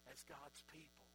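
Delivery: quiet reflective preaching. Acted. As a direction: draw the congregation close, gently.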